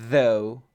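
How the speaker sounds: though with a buzzed TH; vibrating TH. Shown as a demonstration. Teacher coaches student after vibration drill, though with TH. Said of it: "que vibre".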